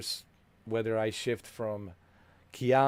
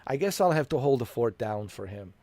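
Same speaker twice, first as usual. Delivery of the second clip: usual speaking voice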